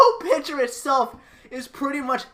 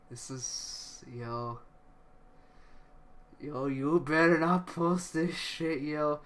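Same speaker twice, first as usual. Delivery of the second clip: despairing mutter; 10 semitones lower, 9 dB quieter than usual. Real, not acted. Pleading quietly to the developer.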